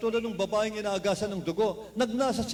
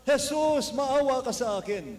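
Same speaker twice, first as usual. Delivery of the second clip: crying out; 4 semitones higher, 3 dB louder, slower than usual. Retold or imitated. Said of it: "Sumisigaw ang bulag".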